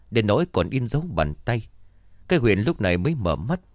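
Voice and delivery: ordinary delivery, nothing marked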